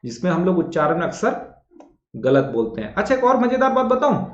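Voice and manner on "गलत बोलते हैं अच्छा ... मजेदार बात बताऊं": ordinary delivery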